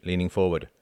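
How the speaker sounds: plain reading voice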